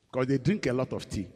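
ordinary talk

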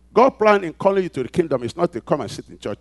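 loud, high, driving pulpit voice; preaching at full force. Acted. Pastor rousing the congregation, preaching at full force. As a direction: build it up and hammer each phrase.

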